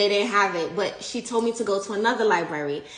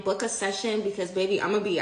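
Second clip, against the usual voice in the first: usual speaking voice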